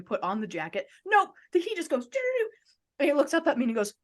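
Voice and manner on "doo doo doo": high-pitched doo-doo-doo; sung-out scribble noise. Retold or imitated. Fan mimics the singer signing instantly, high imitated noise.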